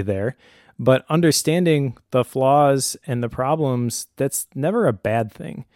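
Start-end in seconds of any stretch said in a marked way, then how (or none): none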